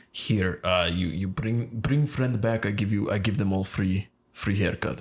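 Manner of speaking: imitated Russian hairdresser, thick accent. Hairdresser offering free cuts for friends, thick Russian accent. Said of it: "very thick Russian accent".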